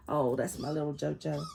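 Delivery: ordinary talk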